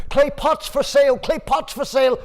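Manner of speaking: high, loud hawking call; street vendor cry. Acted. Father hawking pots.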